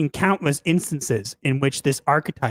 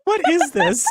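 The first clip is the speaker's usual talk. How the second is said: laughing; 9 semitones higher than usual. Real, not acted. Laughing at the essay.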